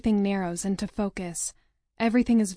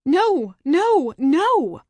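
loud, much higher furious no; shouted, much higher no no no; violent fury; 8 semitones higher, 9 dB louder, much slower than usual. Acted. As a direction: scream the denial in fury. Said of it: "repeat violently with fury".

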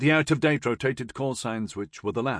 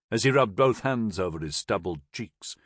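ordinary delivery, nothing marked

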